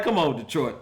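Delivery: normal talking voice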